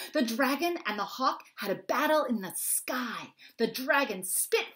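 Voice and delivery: put-on high, slow and eager little kid voice; breathless child narrating a battle; excited kid storyteller. A narrator's reading. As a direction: spin the tale big and wide-eyed. Child voice telling dragon tale.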